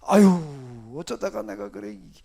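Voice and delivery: low, sighing acted lament. Preacher voicing Jeremiah's lament over his life, low sigh. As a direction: mildly groan it out, pitched low. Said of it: "예레미야도 똑같이 탄식을 했어요".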